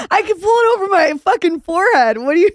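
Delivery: laughing loudly, voice high. Real, not acted. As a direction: talk through a big laugh. Joking about her giant lips.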